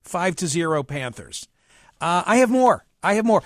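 normal talking voice

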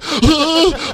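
high squeak of a hurt squirrel; squirrel squeal. Retold or imitated. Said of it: "the squirrel's just like".

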